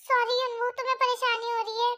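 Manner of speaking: plain reading voice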